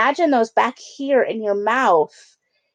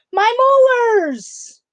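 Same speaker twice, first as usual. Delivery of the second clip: high, loud, slow joyful yell; triumphant shout; 6 dB louder, much slower than usual. Acted. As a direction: shout it out like found treasure. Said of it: "and yelled".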